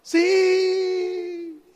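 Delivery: high, long drawn-out yes; acted far-off yell; shouted reply. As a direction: holler back across a mountain. Climber shouts back to God.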